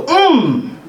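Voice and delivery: high, falling appreciative hum; imitated "mm". Imitating a congregant hearing God, high "mm". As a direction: hum like a moved listener. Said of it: "you may hear somebody go".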